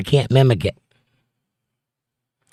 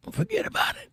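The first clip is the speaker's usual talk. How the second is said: put-on high, soft, half-hearted New York accent; attempted New York accent; 13 dB quieter than usual. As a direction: try a New York accent, barely voiced. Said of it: "I can't do a New York".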